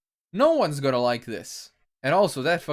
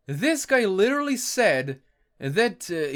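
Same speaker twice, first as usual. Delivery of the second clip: normal talking voice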